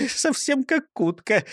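high-pitched, chuckly jibe; mocking amusement; amused sneer. A narrator's reading. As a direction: tease it in a squeaky voice. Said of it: "усмехнулся он".

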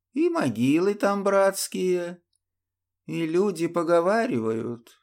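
высокий, неспешный голос старика; рассказывать как седой дед; стариковский голос. Acted. The old man's long tale. (стариковский голос)

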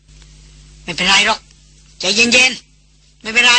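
slow, soothing and even; calm everyone down gently; calm reassurance. A narrator's reading. Eldest brother soothing the group over his sister, calmly. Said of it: "บอกเรียบๆ".

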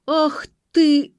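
loud, high furious bellow; explosive acted yell, pitched high. A narrator's reading. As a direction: yell out having caught someone. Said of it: "заорал Юрик".

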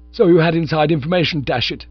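loud indignant accusation. A narrator's reading. Bingo accusing Jeeves of inside knowledge, loud and indignant. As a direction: accuse him outright.

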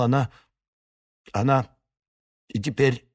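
stammering, broken-off phrases; stuttering. Acted. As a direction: stammer and lose the words. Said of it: "так бы и заикался".

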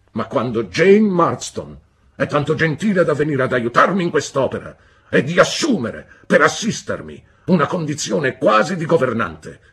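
loud, severe lecturing; stern husband. A narrator's reading. Husband reproaching his wife, loud and stern. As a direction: lay down the law coldly.

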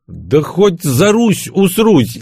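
loud, high, drawn-out nervy outburst; somewhat nervous. A narrator's reading. Narrator's hero answers about the patriotic fund, nervously loud. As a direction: blurt it slowly, pitched right up, a touch nervous. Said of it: "несколько нервно заметил я".

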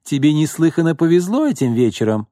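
high, flirty, teasing. Acted. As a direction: lay on the charm. Roxana flirting.

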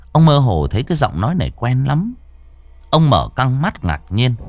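usual speaking voice

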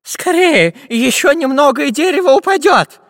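high, loud frantic urging; desperate cry. A narrator's reading. Professor as the tree cracks, crying desperately. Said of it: "отчаянно закричал профессор Пыхтелкин".

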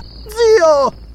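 very high desperate shriek. A narrator's reading. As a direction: shriek for your uncle to stop. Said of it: "strillò Belesa".

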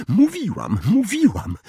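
breathless and high, gasping the words out; out of breath from running, insisting; high winded pant. A narrator's reading. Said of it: "wydyszała Hermiona".

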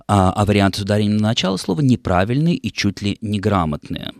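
ordinary talk

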